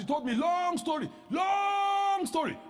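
high, stretched-out long story; drawn-out mock whine. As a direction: drag out long story mockingly. Mocking woman's long tale.

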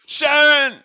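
loud, high mock [name] yell; [name] impression. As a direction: shout the name high and loud, [name] style. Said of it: "I did an [name] accent".

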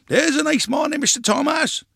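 high, hailing character voice; villager's greeting. A narrator's reading. Man with a whippet greeting Grandpa, hailing.

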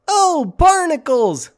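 a high, slow squeaky cartoon voice; SpongeBob. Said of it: "SpongeBob likes to say".

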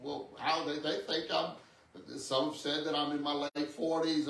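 ordinary delivery

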